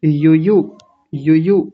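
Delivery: यु यु as two short, quick syllables; quick short vowels. Shown as a demonstration. Teacher shows both short vowels, quick यु यु. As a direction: keep both u sounds in यु यु brief. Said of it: "तो जल्दी जल्दी बोलेंगे".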